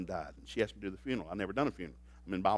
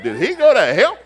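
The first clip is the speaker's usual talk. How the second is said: laughing through a louder repeat of the question; 8 semitones higher, 13 dB louder than usual. Retold or imitated. Preacher retells his own funeral doubt, laughing loudly. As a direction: chuckle it out, half disbelieving. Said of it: "it was messing with me".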